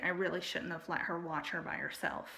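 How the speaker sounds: ordinary talk, nothing marked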